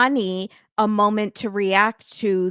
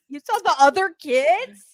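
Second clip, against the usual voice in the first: mock shocked question; 6 semitones higher, 4 dB louder than usual. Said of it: "she goes, spins that head around".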